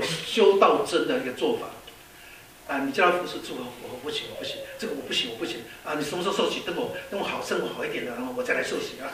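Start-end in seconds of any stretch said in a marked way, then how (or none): 3.6-5.6 soft, quick mumbled excuse; mock timid excuse. Preacher imitating a believer dodging service, soft quick mumbled excuse. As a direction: mumble the excuse away quickly, half-swallowed.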